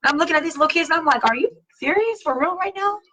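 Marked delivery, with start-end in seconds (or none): 1.2-3.0 disbelief. Retold or imitated. Reacting to grandkids again.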